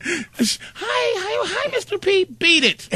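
put-on high chirpy child greeting; high kid voice. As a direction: squeak it like a small kid. Mimicking kid greeting him.

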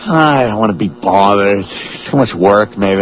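mock reluctance. Man talking himself out of the effort, mock reluctant. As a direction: put on a low, can't-be-bothered voice.

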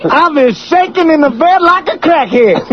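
high-pitched stand-up holler; Chris Rock. Acted. Host riffing on the bedroom bit, Chris Rock impression. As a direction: deliver it as a shouting comedian. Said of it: "That's Chris Rock".

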